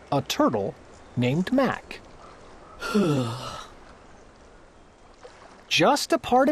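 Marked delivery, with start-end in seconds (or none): 2.8-3.6 low, faint weary sigh. A narrator's reading. Turtle at the bottom of the stack, faint sigh. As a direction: let out a small tired breath. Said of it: "he heard a faint sigh".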